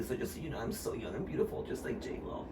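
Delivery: very soft, low near-whisper; hushed mock bragging. Host boasting to co-host about looking young, hushed mock bragging. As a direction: murmur a playful boast under your breath.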